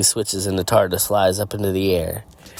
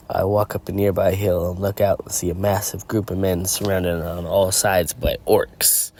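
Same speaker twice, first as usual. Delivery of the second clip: usual speaking voice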